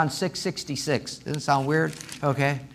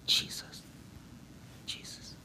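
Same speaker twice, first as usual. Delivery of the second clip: reverent whisper; hushed reverence; 17 dB quieter than usual. Retold or imitated. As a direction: say the holy name under your breath, timidly reverent. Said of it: "It's always like a reverence".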